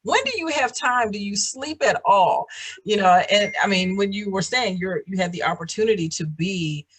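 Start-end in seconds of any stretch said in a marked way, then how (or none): 0.0-2.4 high incredulous exclaim; amazed disbelief. Retold or imitated. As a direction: gasp at their busy schedule. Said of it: "I'm like".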